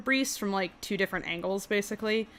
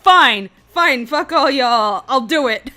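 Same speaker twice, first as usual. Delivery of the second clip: exasperated; 5 semitones higher, 12 dB louder, slower than usual. Retold or imitated. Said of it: "I was like".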